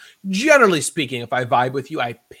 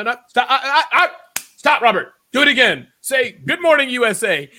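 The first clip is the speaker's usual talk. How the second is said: loud, higher, insistent stop-and-redo; mock coach yelling; 7 semitones higher, 9 dB louder than usual. Coach stopping Robert's rehearsal, loud and high. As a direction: bark corrections like a demanding speaking coach.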